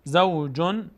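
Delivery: زوجن slowly with a clear final n; as written. Shown as a demonstration. Teacher reads the written word, زوجن with clear n. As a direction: end زوجن on a plain n. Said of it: "تنوین بعدش با آمده".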